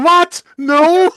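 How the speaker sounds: high, loud "what? no"; shocked refusal. Retold or imitated. Greeting the arriving alien, high loud shock. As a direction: blurt out high loud disbelief. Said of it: "You go".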